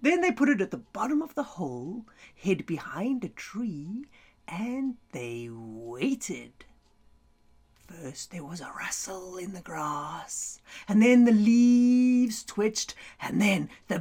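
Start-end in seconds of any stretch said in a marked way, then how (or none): 2.3-6.7 whispering. A narrator's reading. Hiding in suspense. 7.9-10.6 whispering. A narrator's reading. Suspense before the cat.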